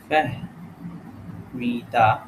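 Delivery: very slow Fahmida, broken into syllables; sounded out slowly. Shown as a demonstration. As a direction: dictate Fahmida piece by piece. Sounding out the answer word.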